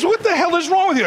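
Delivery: put-on high, exasperated girlfriend; girlfriend's voice. Girlfriend scolding her odd-walking boyfriend, high and exasperated. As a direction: snap at him like an annoyed girlfriend. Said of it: "and she's".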